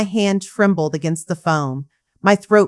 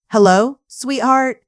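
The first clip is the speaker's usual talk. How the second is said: hopeful greeting; 6 semitones higher than usual. Acted. Mother answers son's call hopefully.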